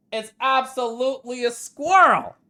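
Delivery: gasping, high, loud excitement; gasp, then cry it out high; real delighted surprise; excited discovery. Real, not acted. Gasps at squirrel reveal.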